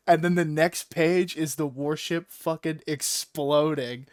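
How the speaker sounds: ordinary talk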